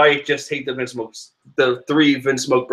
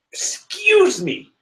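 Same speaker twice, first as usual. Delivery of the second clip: even higher and slower, drawn-out "Excuse me."; even more indignant; much slower than usual. Real, not acted. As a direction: stretch it, pure theatrical offence. Indignant correction.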